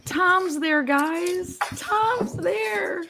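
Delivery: high, thrilled squeal; excited squeal. Real, not acted. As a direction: squeal it, almost crying with joy. Excited spotting.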